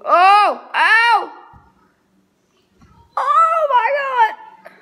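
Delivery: high, loud disgusted cries; shocked shriek. Real, not acted. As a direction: shriek at the sight of it. Reacting to the nasty food.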